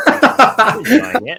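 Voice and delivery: high, slow words squeezed out through laughter; laughing. Real, not acted. Co-host laughs off the therapy-session joke while still laughing.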